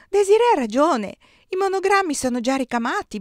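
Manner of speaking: high, excited confirmation starting loud; amazed excitement. A narrator's reading. Giulia backs her sister about the monograms, high and excited.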